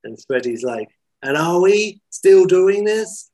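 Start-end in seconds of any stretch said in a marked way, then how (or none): 1.2-3.3 high, loud, drawn-out challenge; pitched-up, insistent query; exasperated question. Acted. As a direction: demand it slowly, voice raised. Freddie challenges the others.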